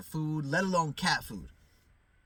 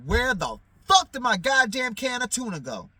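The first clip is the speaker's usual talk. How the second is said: higher, louder demand about the tuna; angry yell; 6 semitones higher, 7 dB louder than usual. Retold or imitated. Retelling his discovery of the missing tuna, loud and furious. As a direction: bark it like you just found the can gone. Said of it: "I'm like".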